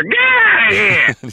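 slow, broad Boston "Get out of here"; hammed-up Boston accent. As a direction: stretch it out like a born Bostonian. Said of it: "I just love your accent".

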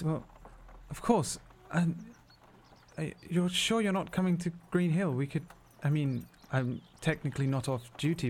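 a flustered guard caught off guard, babbling; flustered soldier stumbling over her words. Acted. GM voices flustered guard McKinnon.

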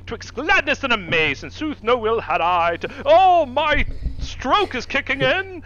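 put-on old-fashioned grandiose grandpa turning to panic; hammed-up grandpa. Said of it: "he was like".

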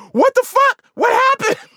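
high-pitched acted yell; mom's alarmed shout. Mom busting into the bathroom after the crash, alarmed high shout. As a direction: burst in shrieking like a startled mother.

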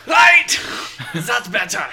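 loud, high pirate captain. Acted. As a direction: bellow like a salty sea captain. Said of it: "Just back up a little bit".

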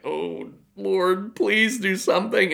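high, pleading retold prayer; desperate plea. Speaker retelling a silent prayer in the room, desperate plea. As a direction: plead upward as if begging for rescue.

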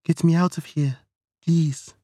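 high, frightened plea, forced out; terrified, straining. Acted. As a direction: squeeze the word out in terror. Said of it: "struggling to force out the words".

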